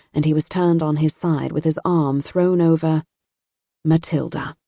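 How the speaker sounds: ordinary delivery, nothing marked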